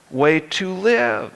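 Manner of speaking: usual speaking voice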